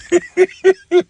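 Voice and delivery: short high burst of real laughter; a laugh that breaks out at how crazy it is. Real, not acted. Preacher laughing at talk of roach milk, real laugh. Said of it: "I'm laughing".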